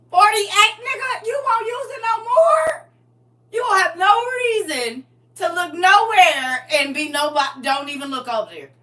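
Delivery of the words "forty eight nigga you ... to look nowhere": high, loud, half-crying yell; hammed-up shrieking yell; mock outraged shout. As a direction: holler it in comic outrage.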